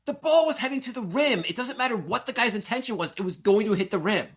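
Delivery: high-pitched, emphatic retold rebuttal; indignant arguing. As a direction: re-enact arguing down a wrong take on the call. Retold argument over the call.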